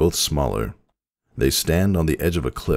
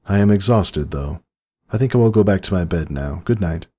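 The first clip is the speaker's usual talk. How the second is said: higher, gentle princess voice; Celestia's voice; 5 semitones higher, 3 dB louder than usual. A narrator's reading. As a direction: speak as an ancient, weary princess.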